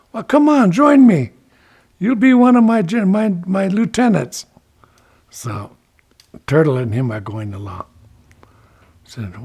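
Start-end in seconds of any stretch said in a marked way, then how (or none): 0.1-4.5 high, loud urging; eager coaxing. Acted. Ichiko offers Turtle a lieutenant's post, eagerly coaxing. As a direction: urge him along, voice pitched up.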